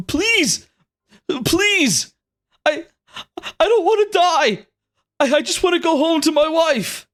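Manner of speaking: high-pitched, desperate mock crying; tearful pleading. Condemned man begging soldiers for his life, crying. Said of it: "he's crying and saying".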